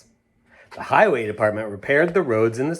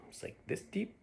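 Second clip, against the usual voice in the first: whisper; 9 semitones higher, 18 dB quieter than usual. Real, not acted. Showing depth to kids.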